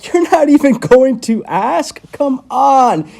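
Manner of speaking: high, loud mock indignation; mock hurt. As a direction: needle him with fake offense. Doctor mocks patient's silence.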